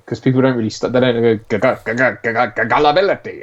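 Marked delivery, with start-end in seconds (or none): 1.5-3.4 mock stutter that finally bursts into the word; exaggerated stutter. Podcaster showing how an actor overdoes stuttering, mock stutter. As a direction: stammer hard on the first syllable. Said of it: "doesn't quite get right is stuttering".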